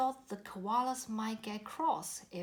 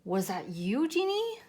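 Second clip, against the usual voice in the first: Penny's voice; 3 semitones higher, 7 dB louder than usual. A narrator's reading. Penny asks a question.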